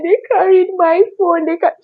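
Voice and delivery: very high, broken-off sob of complaint; crying wail. Retold or imitated. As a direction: wail it and break off mid-word. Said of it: "I just kept crying".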